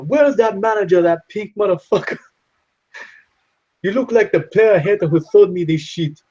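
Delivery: high, accented mock customer rant; movie character rant. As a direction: rant at the manager like the movie guy. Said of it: "He's like".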